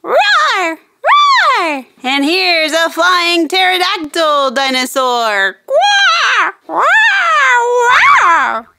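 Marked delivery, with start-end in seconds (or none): 0.0-1.8 mock toy dinosaur roar, rising; playful dino roar. Host voicing toy dinosaur for kids, playful roar. 5.7-8.7 mock toy dinosaur roar, rising; playful dino roar. Host voicing toy dinosaur for kids, playful roar.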